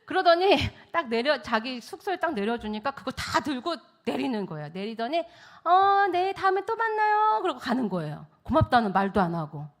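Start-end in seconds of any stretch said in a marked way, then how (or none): 5.6-7.4 high, loud, sing-out farewell; breezy goodbye. Acted. Passenger leaving the driver without thanks, high breezy goodbye. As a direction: imitate someone breezily calling goodbye.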